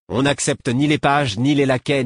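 high and loud, contemptuous listing; sneering. Acted. Young guard mocking a servant, high loud sneer. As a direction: spit out each servant rank with scorn.

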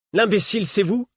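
high-pitched comeback; indignant retort. A narrator's reading. Boy interrupting the woman's tirade, indignant. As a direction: throw the insult back, pitched well up. Boy throws the insult back.

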